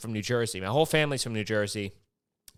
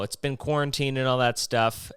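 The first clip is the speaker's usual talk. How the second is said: ordinary talk, nothing marked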